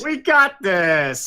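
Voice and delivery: slow mock copy of a boast; mocking echo. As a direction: parrot the boast, slowly. Mocking the eager character.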